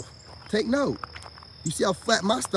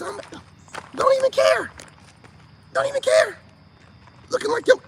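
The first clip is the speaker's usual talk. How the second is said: squeaky high mocking falsetto; mock high woman voice. Mocking women.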